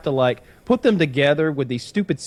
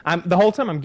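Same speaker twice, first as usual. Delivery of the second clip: usual speaking voice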